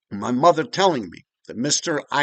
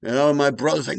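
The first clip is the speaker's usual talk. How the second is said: usual speaking voice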